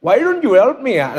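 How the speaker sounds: laid-back complaint in an imitated Indian accent; mock Indian-accented grumble. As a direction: put on an Indian accent, mildly angry. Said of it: "kalau marah paling nyantai itu orang India".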